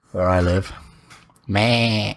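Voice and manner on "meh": imitated drawn-out sheep bleat. Joking about sheep in the village, bleating.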